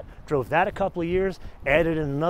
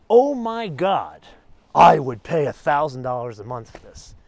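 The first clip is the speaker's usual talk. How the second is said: awed gushing; 3 semitones lower, 9 dB louder than usual. Retold or imitated. Car shopper eyeing an expensive sports car, mock-gushing loudly. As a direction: gush loudly like an overawed buyer, stretching "Oh my God".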